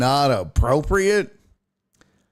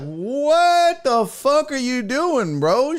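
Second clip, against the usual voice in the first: higher, slow, incredulous what the fuck; drawn-out mock outrage, pitched up; mock outraged; 9 semitones higher, slower than usual. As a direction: stretch it out in disbelief. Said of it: "You're like".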